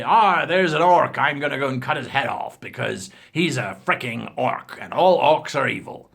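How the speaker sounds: mock eager orc-slayer shouting; gung-ho player. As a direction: bellow it like a hack-and-slash player. Player blindly attacking orcs.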